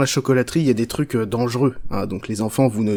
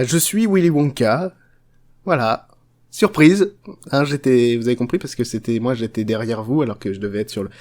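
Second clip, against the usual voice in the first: ordinary talk, nothing marked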